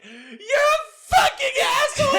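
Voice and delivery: very high, loud tearful shriek, slowed; comic mocking impression of an upset girl yelling; mock crying scream. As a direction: imitate a sobbing girl screaming insults. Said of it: "one of them was like".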